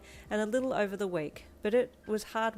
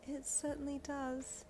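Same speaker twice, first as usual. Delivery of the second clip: put-on higher, softer young woman; gentle, shy girlfriend voice; soft girl voice; 7 semitones higher, 8 dB quieter, slower than usual. Narrator voices the heroine.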